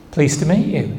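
small, quiet acted greeting; subdued polite reply. Martha answering Jesus's startling introduction, subdued. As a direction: drop to a soft, understated murmur.

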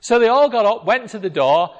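usual speaking voice

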